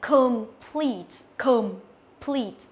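"complete" slowly, schwa in com, long PLEET; re-read "complete" in two careful, falling syllables; slow teacher model of "complete"; correct pronunciation repeated. Shown as a demonstration. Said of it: "Cô đọc lại là".

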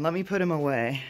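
ordinary delivery

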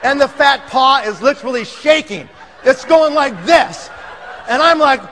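plain reading voice

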